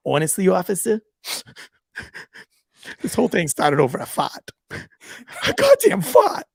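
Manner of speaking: breaking into laughter at the punchline; laughing high on "a goddamn fart". Retold or imitated. Cop retelling the husband's confession, cracking up laughing.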